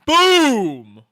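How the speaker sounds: loud, high, falling "Boom"; excited boom. Real, not acted. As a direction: shout the hit with glee. Cheering an impact on screen.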